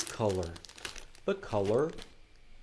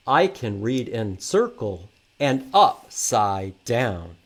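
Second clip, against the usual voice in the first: put-on loud, lively read-aloud for kids; reading to children; 7 dB louder than usual. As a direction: read the rhymes out big to the class. Reads picture book aloud.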